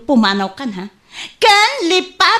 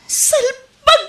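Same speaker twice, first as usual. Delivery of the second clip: angry crying scold; 11 semitones higher, 5 dB louder than usual. Acted. Wife scolding husband, crying.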